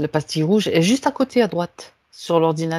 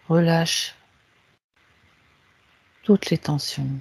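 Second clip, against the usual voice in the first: guided meditation; 3 semitones lower, 7 dB quieter than usual. Acted. Leads a guided relaxation. (voix douce de méditation guidée)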